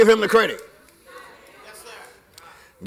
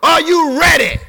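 shouting, high and loud, building; full force preaching; 7 semitones higher, 5 dB louder, slower than usual. Real, not acted. Preacher shouting to the congregation, full force. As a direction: preach it out over the music.

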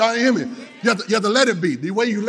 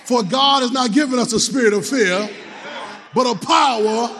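full-force sermon delivery; preaching; 7 semitones higher, slower than usual. Acted. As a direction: preach it out to the church.

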